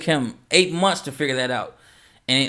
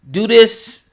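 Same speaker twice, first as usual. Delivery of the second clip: loud, higher, punchy order; firm loud command; 6 semitones higher, 9 dB louder than usual. Retold or imitated. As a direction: bark the fix like a confident mentor. Mentor tells producer the fix.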